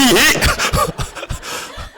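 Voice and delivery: airy unvoiced giggle; breathy laugh. Real, not acted. Teacher laughs at own joke. (숨 섞인 웃음)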